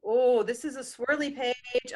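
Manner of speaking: usual speaking voice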